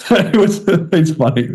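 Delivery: high, laughing words. Real, not acted. Laughing about comment.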